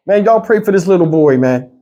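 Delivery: ordinary delivery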